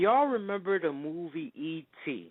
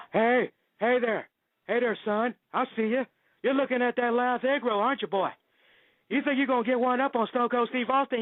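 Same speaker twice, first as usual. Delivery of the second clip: much higher, louder tough-guy wrestler voice; Stone Cold impression; 11 semitones higher, 3 dB louder than usual. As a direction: threaten the kid at the buffet like a wrestling promo. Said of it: "Do not ever ask me to do a Stone Cold Steve Austin impression".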